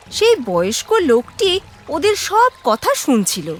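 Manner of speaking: ordinary delivery, nothing marked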